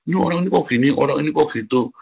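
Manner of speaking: judgmental gossip. Retold or imitated. Friend judging people to the preacher, judgmental gossip. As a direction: mimic a fault-finder picking on people.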